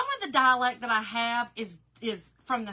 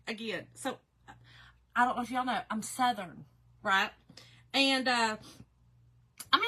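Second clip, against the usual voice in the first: usual speaking voice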